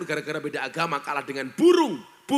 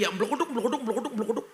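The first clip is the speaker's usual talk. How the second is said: mock ngapak babble; 4 semitones higher, much faster than usual. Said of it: "Orang ngapak yang ngomong kayak gini".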